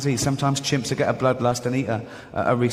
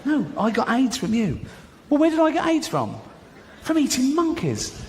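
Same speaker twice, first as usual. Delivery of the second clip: high, indignant back and forth; high squabbling; 10 semitones higher than usual. Acted. Man arguing with the chimp, high and indignant. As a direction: bicker in a shrill, high pitch.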